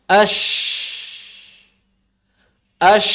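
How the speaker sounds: Ash with steady air; flowing shin. Shown as a demonstration. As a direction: let air flow on ash. (shin dialirkan)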